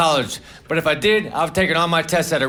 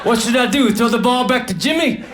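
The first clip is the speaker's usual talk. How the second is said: higher-pitched mock exasperation; exasperated pool actors; 5 semitones higher than usual. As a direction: whine like an actor stuck in a shallow pool. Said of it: "the actors would have said".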